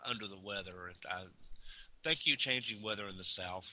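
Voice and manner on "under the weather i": congested, sinus-blocked voice; stuffy nose. Real, not acted. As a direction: talk through a stuffy nose. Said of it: "Sorry if I sound a little, uh, a little under the weather".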